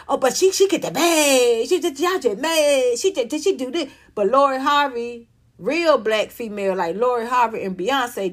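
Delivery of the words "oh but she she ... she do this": mocking imitation. Mimicking fans who say she gets the bag, mocking. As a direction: imitate gushing defenders, stretching the ends.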